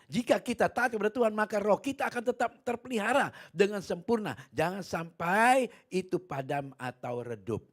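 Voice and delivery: loud, high sermon voice; preaching at full force. Real, not acted. Preacher exhorts the church loudly about keeping the spirit. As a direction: proclaim it to the congregation.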